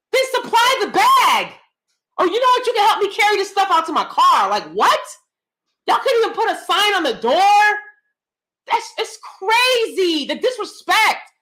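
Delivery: a vlogger ranting at the store about bag fees; indignant rant. Real, not acted. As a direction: rail against the store's disrespect.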